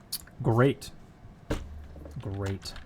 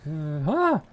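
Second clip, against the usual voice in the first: small grunt, then louder mock yell; imitated scare yell; 4 semitones higher, 5 dB louder than usual. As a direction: mimic the little sound before a yell. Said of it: "a weird little, like, sound right before the actual yell".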